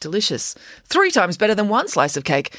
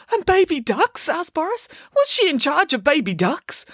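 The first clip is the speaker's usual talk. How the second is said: put-on high, eager childlike bear; 12 semitones higher than usual. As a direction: ask breathlessly like a big child. Boris asks.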